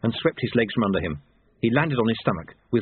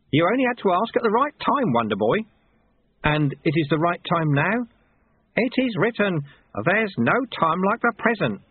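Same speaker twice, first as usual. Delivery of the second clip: lightly voiced sly sweeper, pitched up; a hint of a teasing mentor; high, lightly played teacher's needling; wily old master; 8 semitones higher than usual.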